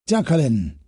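a pleased professor calling her name; Solinsky beaming. Acted. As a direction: greet her name with delight. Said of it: "his face beaming with pleasure".

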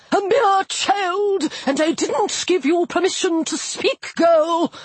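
slow, high hissing snap; snapped. A narrator's reading. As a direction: snap it like deflating air. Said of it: "the aviator snapped".

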